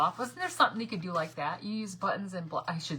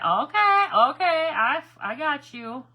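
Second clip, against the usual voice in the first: much higher and louder, eureka; thrilled okay okay; excited realisation; 7 semitones higher, 10 dB louder, slower than usual. Real, not acted. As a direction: shout it as it finally clicks. Finally understanding instructions.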